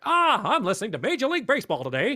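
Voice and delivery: high, fast, hyped American caster voice; mock American caster. Mocking American baseball casters on a podcast, hyped and fast. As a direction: rattle it off like an overexcited US caster. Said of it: "it's like".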